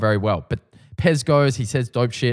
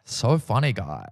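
sarcastic fake laugh; 3 semitones lower, 5 dB quieter, slower than usual. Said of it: "he's like".

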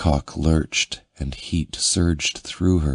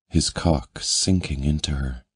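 plain reading voice

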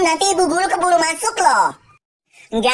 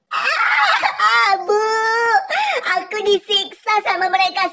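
high whining sing-song mock sob; fake crying; 7 semitones higher than usual. Bully fake-crying to the teacher about the girls. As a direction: whine like a crybaby.

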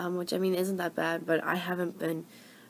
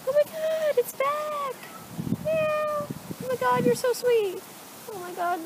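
pet talk; 12 semitones higher than usual. Real, not acted. Cooing at a friendly cat.

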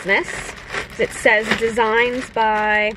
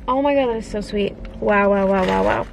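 usual speaking voice

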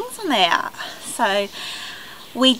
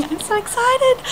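excited squeal; 12 semitones higher than usual. Real, not acted. Vlogger squealing to viewers that the parcel man is coming, excited squeal. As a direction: squeal it with glee.

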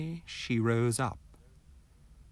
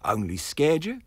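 unsympathetic; 6 semitones higher, 5 dB louder than usual. Acted. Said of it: "queried the peddler, unsympathetically".

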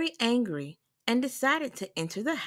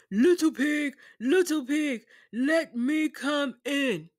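higher, louder big bad wolf; 6 semitones higher, 3 dB louder than usual. A narrator's reading. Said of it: "Along came a wolf and knocked at the door and said".

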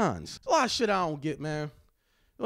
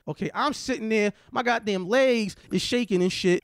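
usual speaking voice

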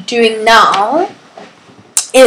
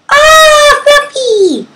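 very high, louder cry of joy; delighted squeal; 4 dB louder, much slower than usual. Real, not acted. Greeting her pet dog Fluffy, high squeal. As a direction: squeal the dog's name in delight.

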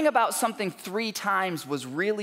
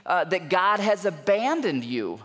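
usual speaking voice

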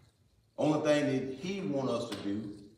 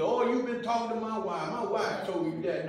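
higher-pitched imitation of a suspicious listener; mock accusation; 7 semitones higher than usual. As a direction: act out an indignant churchgoer, pitched up. Voices people accusing the preacher.

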